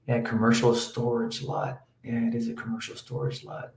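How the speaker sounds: very quiet, absent-minded mumble; talking to oneself. Real, not acted. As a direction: murmur to yourself while looking something up. Muttering to himself while checking the screen.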